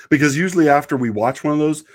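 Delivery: usual speaking voice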